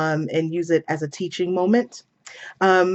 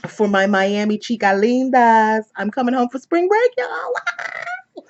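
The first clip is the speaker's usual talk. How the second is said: excited; 6 semitones higher, 3 dB louder, slower than usual. Real, not acted. Vlogger telling Miami girls she's coming home, real excitement. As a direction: voice climbing into a high squeal. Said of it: "I'm so excited to go home".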